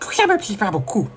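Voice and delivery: high, drooling nerd voice. Acted. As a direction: slobber the words, nerdy. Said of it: "la voix va être un peu aléatoire avec la bave".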